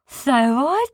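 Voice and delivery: very slow, high, pleased; dawning pride, drawn out; Sniz voice. A narrator's reading. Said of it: "said Sniz".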